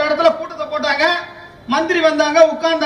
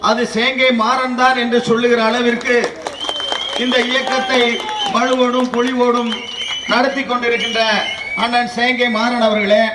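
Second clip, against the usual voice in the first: formal speech; 5 semitones lower than usual. Acted. Formal greetings opening a speech.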